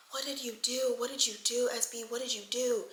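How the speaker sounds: soft, repeated worried question; imitated hushed alarm. Woman questions man after the call, hushed alarm. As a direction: ask it over and over under your breath.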